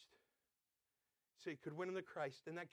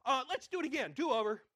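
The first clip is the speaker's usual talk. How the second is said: put-on higher, louder kid's demand; whiny do-over; 6 semitones higher, 10 dB louder than usual. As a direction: cry foul like a sore loser. Kid demanding a redo.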